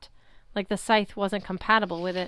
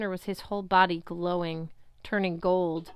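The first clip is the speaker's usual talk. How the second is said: ordinary delivery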